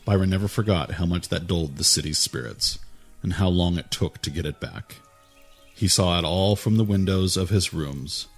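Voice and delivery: ordinary delivery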